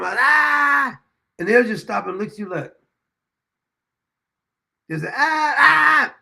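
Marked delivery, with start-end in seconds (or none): 0.2-1.0 a loud, high mock wail like a crying baby. Imitating the baby's cry loudly for a laugh. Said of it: "I would cry with them". 5.2-6.1 loud, high crying wail; mock baby cry. Mimicking a crying baby to stop its tears. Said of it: "I cry with them".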